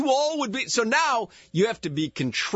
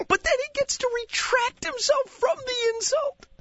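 nature documentary. Pretend. Mock wildlife narration of cohost.

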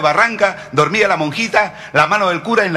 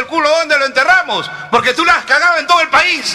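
high, loud jab at the punchline; loud comic retort; mock indignant shout; 8 semitones higher, 3 dB louder than usual. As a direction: fling the question out loud and mocking.

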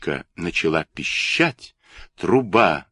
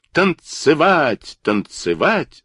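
slow, drawn-out call, first word high; crying out; 8 semitones higher, slower than usual. A narrator's reading. Kurilka orders the toys to dance, drawn-out calling. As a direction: call it out, stretching each word. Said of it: "закричал Курилка".

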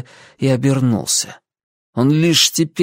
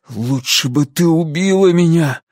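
higher, bitter outburst; anguished exclamation; 5 semitones higher than usual. A narrator's reading. Ansel wishing she had killed him, anguished exclamation. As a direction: exclaim it, cutting in on her. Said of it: "воскликнул он".

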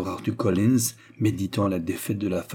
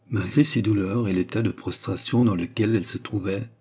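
usual speaking voice

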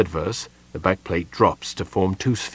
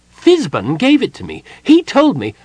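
high, indignant Kender voice, much higher and louder; insulted Kender; 12 semitones higher, 9 dB louder than usual. A narrator's reading. Tass denies taking the artifact, insulted and high. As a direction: bristle at the accusation, voice up high.

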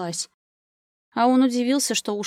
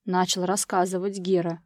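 ordinary delivery, nothing marked

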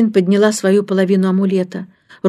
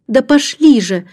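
put-on higher-pitched, urgent child; agitated impatience; 7 semitones higher, 4 dB louder than usual. A narrator's reading. Джейн eager to rush home to nanny, agitated. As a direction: hurry them along impatiently. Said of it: "топнула ногой от волнения".

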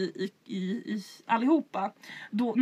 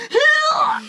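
shocked cry; 9 dB louder than usual. Retold or imitated. Panicked yelp on hearing the [name] question.

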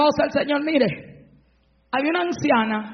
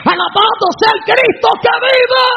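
shouted praise; 8 semitones higher, 7 dB louder than usual. Real, not acted. Preacher rousing the congregation to praise, high loud shout.

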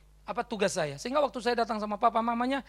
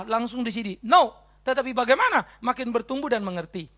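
preaching at full force; 3 semitones higher, 6 dB louder than usual. Acted. Preacher builds to exhortation.

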